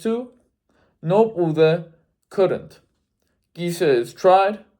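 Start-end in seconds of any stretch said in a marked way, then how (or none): none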